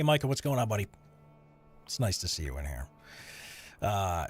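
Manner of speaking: normal talking voice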